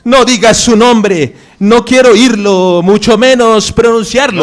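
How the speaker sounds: high, loud, distressed plea; agitated protest. Acted. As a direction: cut him off with urgent refusal. Begs him not to say name. (súplica angustiada, aguda y fuerte)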